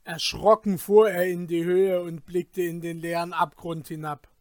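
ordinary delivery

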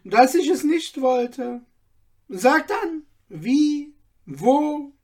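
put-on the old man keen to get to work; eager old tailor. A narrator's reading. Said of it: "rief der Alte". (eifriger Alter, viel höher und lauter)

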